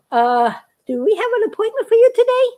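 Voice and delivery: lightly voiced receptionist, high and polite; a hint of a sweet front-desk lady asking. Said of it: "the other lady comes up from the back and said".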